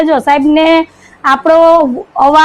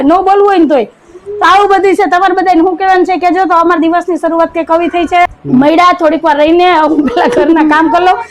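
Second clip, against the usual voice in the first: ordinary talk, nothing marked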